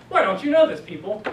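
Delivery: sudden loud, high mock exasperation; mock scolding. Teacher pretending to push students for not knowing, mock scolding. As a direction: playfully badger the class, pitch jumping up. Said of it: "I'm pushing you 'cause I want".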